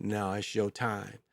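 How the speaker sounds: low, soft, slow refusal; unmoved Death. Retold or imitated. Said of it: "just looked unmoved and said".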